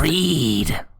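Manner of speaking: loud, strange one-word command; a disembodied voice insisting; eerie unseen voice commanding the druid to read; mysterious voice. Acted. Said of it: "you hear the voice again".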